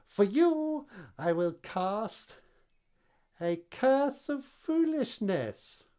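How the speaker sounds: high, slow gloating hag; witch voice. Acted. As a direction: cast the curse like a witch.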